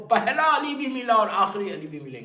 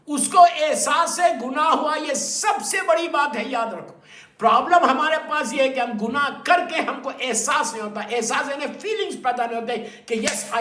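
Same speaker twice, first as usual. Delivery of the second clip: emphatic preaching; 6 semitones higher, 7 dB louder than usual. Real, not acted. Preacher stressing his message.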